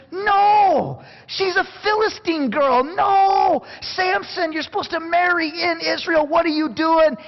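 high, pleading mock father; father protesting his son's choice; upset father. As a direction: play the exasperated father, voice up high. Father scolding son Samson.

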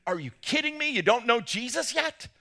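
stunned, high-pitched are-you-kidding-me; incredulous exclaim. Retold or imitated. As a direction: blurt it out like you can't believe it. Retells shocked opening line to strangers.

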